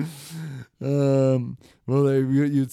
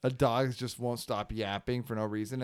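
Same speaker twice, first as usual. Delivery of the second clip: usual speaking voice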